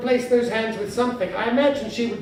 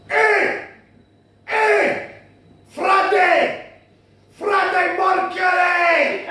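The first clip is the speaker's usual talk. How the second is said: loud, high guttural Glaswegian holler; 9 semitones higher, 6 dB louder, much slower than usual. Acted. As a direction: bellow it in a thick Glaswegian accent, drawn out.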